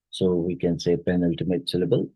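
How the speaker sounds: normal talking voice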